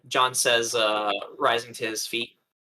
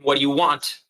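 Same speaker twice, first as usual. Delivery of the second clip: raised, loud challenge; insistent demand; 3 semitones higher, 5 dB louder than usual. Acted. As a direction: press the creature loudly for an answer. Character repeats demand to creature.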